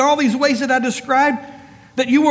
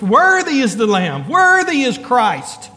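loud crying out. Retold or imitated. Preacher quotes the worshippers in heaven, crying out loudly. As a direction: cry it out as heaven's praise. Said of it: "They cried out in a loud voice".

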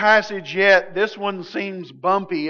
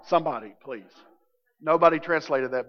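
normal talking voice

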